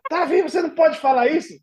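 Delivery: high, scolding woman's voice; a high prim scold; host mother voice. Retold or imitated. Host mother scolding the au pair: high reproachful voice.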